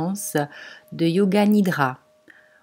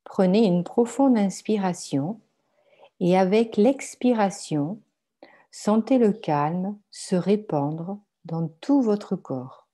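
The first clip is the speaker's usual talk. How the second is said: guided meditation; 4 dB quieter than usual. Acted. Guides a yoga nidra meditation. (méditation guidée, voix douce et posée)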